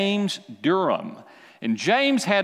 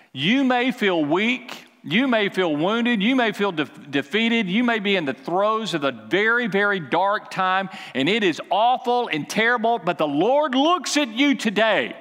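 preaching; 5 semitones higher than usual. Acted. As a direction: build the sermon with fervor. Preaching builds to congregation.

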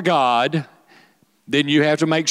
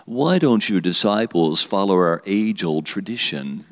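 slow, stuffy Pharisee question, pitched down; mock Pharisee voice; 4 semitones lower than usual. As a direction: put on a slow, lower voice as a self-important Pharisee. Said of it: "this is how they said it like this".

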